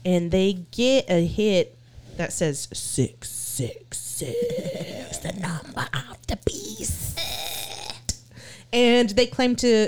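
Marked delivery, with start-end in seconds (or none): 2.7-7.2 high, slow, eerie whisper; creepy whisper. Retold or imitated. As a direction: whisper it like a demon. Imitating the EVP voice.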